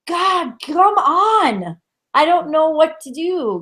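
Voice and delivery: frustrated. Retold or imitated. Collective guides losing patience with people, frustrated. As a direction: groan it slowly as the fed-up guides. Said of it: "they were so frustrated".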